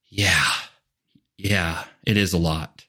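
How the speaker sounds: low, sighing relief; relieved sigh. Retold or imitated. Overwhelmed employee finally feeling heard, sighing. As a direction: let the tension out on a sigh. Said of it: "there's this sigh of".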